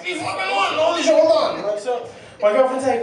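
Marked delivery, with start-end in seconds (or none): 0.0-1.7 excited news. Retold or imitated. He relays news to girlfriend.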